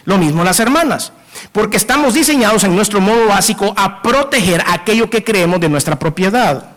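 ordinary talk, nothing marked

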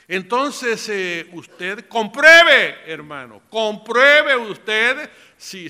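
loud, slow preaching with key words drawn out; forceful exhortation. Real, not acted. Preacher urging believers to test their anointing, forceful exhortation. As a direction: urge the congregation hard, stretching the command.

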